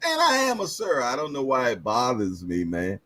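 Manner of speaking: normal talking voice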